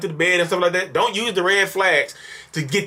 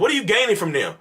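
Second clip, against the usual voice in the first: ordinary talk, nothing marked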